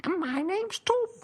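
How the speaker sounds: high put-on comic voice; squeaky cartoon character; silly character voice.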